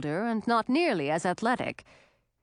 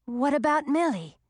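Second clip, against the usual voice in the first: higher-pitched, worried question; a touch of worry, voice raised; anxious; 7 semitones higher than usual. A narrator's reading. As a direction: ask with mild anxious urgency. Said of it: "Audun asked anxiously".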